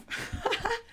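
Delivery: a quick high laugh, mostly breath; high burst of laughter. Real, not acted. Laughing at her own good grade, a high laugh.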